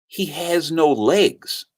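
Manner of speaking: slow, measured delivery at normal pitch; grave revelation. Retold or imitated. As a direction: let the news land slowly and plainly. Wife's revelation, retold.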